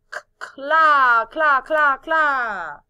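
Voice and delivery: "cla" repeated loud and high, each stretched; isolated syllable repeated. Shown as a demonstration. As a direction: drive home "cla, cla, cla" after a lone C sound. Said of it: "¿Y dónde está la combinación". ("cla, cla, cla" repetido con fuerza, alargado)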